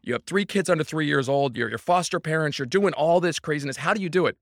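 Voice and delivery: high, amazed questioning voice of people asking; imitating curious fans. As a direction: mimic a wide-eyed fan pestering him. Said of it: "And if everyone's".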